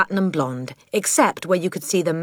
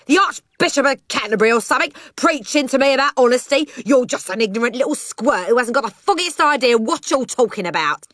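high-pitched, furious cockney tirade; angry shouting; 9 semitones higher, 3 dB louder than usual. Acted. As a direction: rant at the child who lectured you. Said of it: "he shouted".

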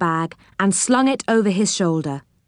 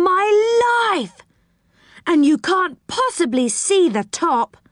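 amazed; 11 semitones higher than usual. Acted. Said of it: "in great astonishment".

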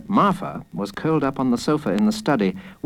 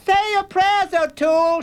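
high, girl's mock-fierce threat; high-pitched play-fight menace; playful snarl. A narrator's reading. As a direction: snarl like a kid playing pirate. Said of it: "she snarled".

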